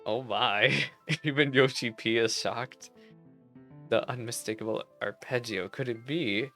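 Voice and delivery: normal talking voice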